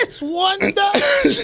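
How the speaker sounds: high, slow, drawn out through laughter; laughing repeat. Real, not acted. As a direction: savor the punchline while laughing. Host repeats punchline, cracking up.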